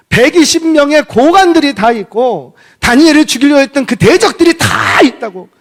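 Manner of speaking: high, loud, insistent listing; forceful preaching. Real, not acted. Preacher piling up the officials present, loud and impassioned. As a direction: drive each phrase up and out.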